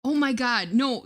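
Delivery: usual speaking voice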